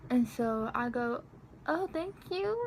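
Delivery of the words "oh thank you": high, squeaky-polite awkward thanks; imitated uncomfortable little thank-you. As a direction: pitch it way up, acting out embarrassment. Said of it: "I feel so awkward".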